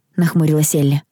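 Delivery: usual speaking voice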